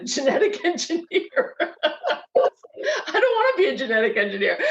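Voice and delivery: amused giggly aside; laughing. Real, not acted. Laughing about reunion.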